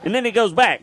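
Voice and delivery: usual speaking voice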